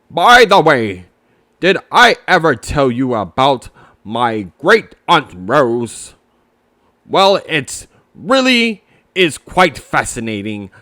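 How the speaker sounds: put-on a pompous old owl launching into a tale; chattering Owl. Owl telling Pooh about his great aunt, rambling owl voice.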